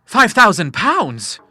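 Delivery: slow, high, loud disbelief; astonished gasp. A narrator's reading. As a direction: stretch out the amount in amazement. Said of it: "he gasped".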